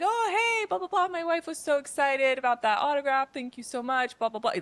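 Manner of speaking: high-pitched chatty rambling, easing down gradually; imitating a gushing, rambling driver; rambling cab driver. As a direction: gush on as an excited, chattering cabbie. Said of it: "Like, just rambling".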